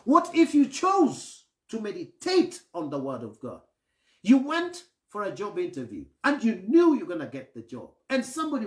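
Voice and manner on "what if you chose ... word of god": ordinary talk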